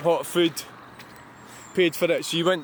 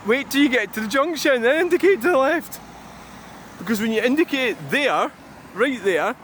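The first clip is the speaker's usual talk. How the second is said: annoyed rant; 7 semitones higher than usual. Real, not acted. Ranting at a bad driver.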